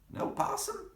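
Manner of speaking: put-on high puzzled old-lady voice; high granny squeak.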